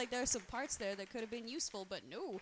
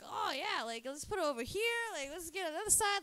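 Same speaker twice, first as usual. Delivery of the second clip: eager; 6 semitones higher, 4 dB louder than usual. Retold or imitated. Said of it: "and then I'm like".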